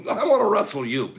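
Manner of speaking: ordinary talk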